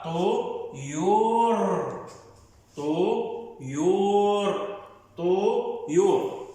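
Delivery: slow, stretched طو يور split apart; syllable drill. Shown as a demonstration. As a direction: pull طو and يور apart, drawn out. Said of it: "كون مقطع". (تقطيع بطيء: طو، يور)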